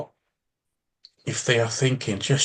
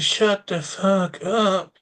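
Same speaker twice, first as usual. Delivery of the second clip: silent inner scream; 6 semitones higher, much slower than usual. Retold or imitated. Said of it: "and I'm thinking".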